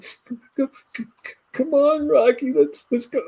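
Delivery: high, stammering imitation of real sobbing; blubbering. To the dog Rocky in the basement, imitating choked blubbering. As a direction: choke the words out through imitated tears. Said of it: "I'm blubbering".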